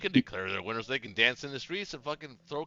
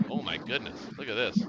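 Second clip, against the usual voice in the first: ordinary delivery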